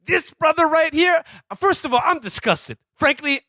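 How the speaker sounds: high, emphatic sports-pundit outrage; hammed-up indignant commentator, pitched high; Stephen A. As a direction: declare your disgust like an indignant TV pundit. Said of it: "I need Stephen A to weigh in".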